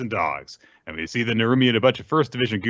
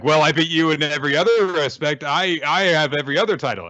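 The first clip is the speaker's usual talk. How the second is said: higher, louder smug boast; Narumi boasting; 8 semitones higher, 3 dB louder than usual. Retold or imitated. Narumi firing back at Hoshina, lightly voiced smug boast. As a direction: puff up and gloat. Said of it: "and then he's like".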